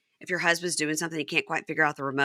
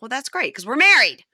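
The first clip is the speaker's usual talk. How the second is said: high, loud mock sass; sassy retort; 8 semitones higher, 8 dB louder than usual. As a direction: snap back with attitude. Wife's sassy reply.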